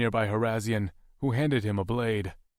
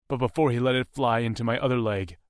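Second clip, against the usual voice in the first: plain reading voice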